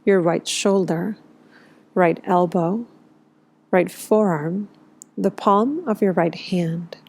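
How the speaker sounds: calm body-scan delivery, leaving space after each phrase; guided meditation. Real, not acted. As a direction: guide them gently into rest, phrase by unhurried phrase. Leads a yoga nidra practice.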